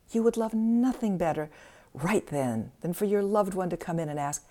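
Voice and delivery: ordinary delivery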